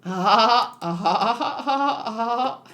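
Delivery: hammed-up laugh; attempted laugh. Shown as a demonstration. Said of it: "I can't do him".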